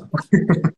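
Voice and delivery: short, loud burst of laughter; real chuckle, loud and brief. Real, not acted. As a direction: break into a quick loud laugh. Laughs after stumbling on "confidence.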